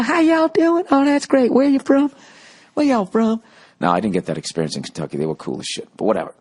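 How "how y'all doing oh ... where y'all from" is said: very high, sugary mock-friendly greeting; fake polite. Local greeting someone he hates, exaggerated fake politeness. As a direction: imitate over-sweet Southern hospitality, louder and high. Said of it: "I'm gonna smile in your face".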